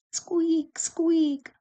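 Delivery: very high squeaky lantern noise; high-pitched creak on each word; squeaking lantern. A narrator's reading. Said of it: "went the lantern as it swung".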